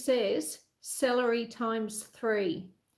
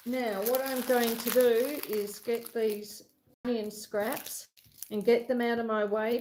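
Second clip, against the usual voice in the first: ordinary talk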